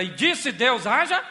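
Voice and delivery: 1 booming scripture. Acted. Preacher quoting Genesis.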